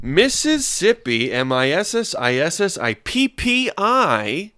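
slow, swooping newsreader delivery; slow news read. Acted. Host reading Slow News Day headline to listeners, drawn-out. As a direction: read it out very slowly, pitch rising and dipping.

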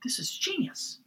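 awed whisper. Retold or imitated. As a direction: breathe it in quiet wonder. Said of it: "I look at it, I'm like".

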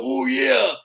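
loud, high wrestler catchphrase voice; Macho King impression. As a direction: call out like a wrestling showman. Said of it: "I'm gonna do Macho King".

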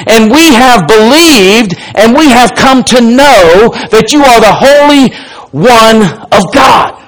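rising to a ringing cry on "of God"; fervent confession. Acted. Peter to Jesus, confessing him as Holy One, fervent and ringing. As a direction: proclaim it with full conviction.

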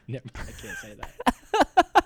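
high, slow put-on girly whine; mock prissy voice. Co-host mocking her line in a high whiny voice. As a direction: mimic her squeaky refusal.

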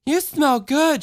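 put-on high childlike chatter; little girl voice. A narrator's reading. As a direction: speak up like a small girl. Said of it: "she said".

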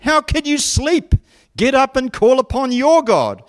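high-pitched, urgent rebuke; indignant captain. Acted. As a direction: rouse a sleeper with a sharp telling-off. Captain scolds sleeping Jonah.

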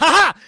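loud, high gloating laugh; sharp burst of gloating laughter; triumphant "ha ha". Real, not acted. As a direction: crow it in victory. Gloating at beating other to joke.

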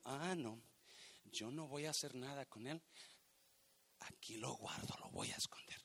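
murmured, hoarse hush to the hidden coin; secretive hush. Acted. Lazy servant hides his coin, hushed secretive murmur. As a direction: croon to the talent like a hidden treasure.

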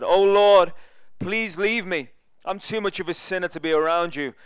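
loud cry to the Lord, easing off; pleading outcry. Acted. Simon Peter on his knees before Jesus, pleading outcry. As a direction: cry out as a sinner begging Jesus to leave.

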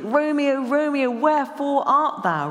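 high, loud stage declamation; theatrical Shakespeare calling; dramatic declaiming. Retold or imitated. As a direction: cry it out like a stage Juliet. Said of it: "A question's dramatic".

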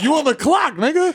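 high, incredulous yo on the clock; incredulous question. Retold or imitated. Teasing his cop friend, sounding high and incredulous. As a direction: pitch it way up in mock disbelief. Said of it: "I'm like".

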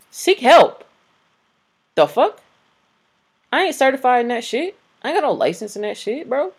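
loud, high-pitched indignant outburst; exasperated. Real, not acted. Exasperated rant at oversharing men.

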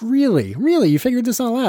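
high-pitched, hammed-up disbelief; mock astonishment. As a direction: leap up high as if amazed. Acted surprise at the reported plot.

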